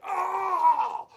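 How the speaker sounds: sudden high yelp of pain. Retold or imitated. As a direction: yell out as the rock hits your ankle. George hit in the ankle.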